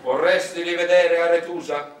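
loud, solemn god asking; Zeus voice. Acted. Zeus offers Alfeo a chance, solemn and loud. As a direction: speak as a mighty god. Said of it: "Zeus l'assoluto volle intervenire".

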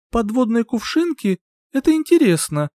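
high, bright feigned curiosity; evasive interest. Acted. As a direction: dodge the question with fake delight. Dodging the question, feigned interest. (уклончивый интерес)

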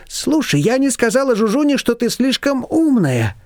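high, a touch of nerves, slowing at the end; nervous Shushana. A narrator's reading. Shushana worrying aloud to Nina, high and a bit nervous. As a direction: fret a little, pitched high. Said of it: "Шушана занервничала".

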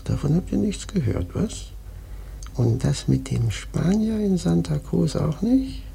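normal talking voice

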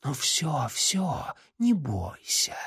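soft eerie reassurance; calm him like living shadow; soothing. Acted. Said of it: "успокоила тень".

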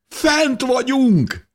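high, drawn-out joyful cry; exultant shout. A narrator's reading. Repeating the news to the diggers below, overjoyed. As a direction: call it again, leaning on Fent.

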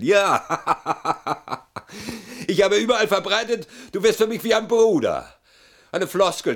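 high, loud gloating through a laugh; loud, high, cackling triumph; bitter laughing boast. Acted. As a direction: laugh it out, crowing over the old trick.

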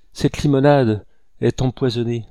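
words chopped to stall; halting confession. A narrator's reading. Cornered woman playing for time confesses, haltingly. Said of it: "murmura-t-elle en hachant ses paroles".

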